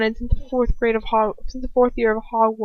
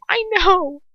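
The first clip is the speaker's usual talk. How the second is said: a hint of high desperation; understated desperate reply; desperately; 10 semitones higher than usual. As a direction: plead with a touch of despair. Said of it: "I say desperately".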